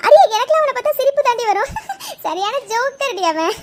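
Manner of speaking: scoffing through open laughter; laughing mockery. Cheering up a scared friend, laughing derisively at the bully. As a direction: laugh him off as ridiculous.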